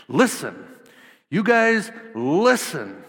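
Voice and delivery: loud, commanding call to listen; emphatic command. Shown as a demonstration. Jesus commanding the crowd to listen, emphatic. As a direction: order the crowd to listen, with weight. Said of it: "He says it in a way that's emphatic and imperative".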